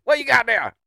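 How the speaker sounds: high, very loud imitation holler; mock yell. Mocking the old man yelling at random kid, shouted. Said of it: "just would yell at some random kid".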